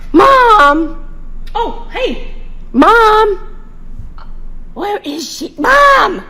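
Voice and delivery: loud high calls for mom; calling out. Real, not acted. Daughter shouting for her missing mom. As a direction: shout across the room to find her.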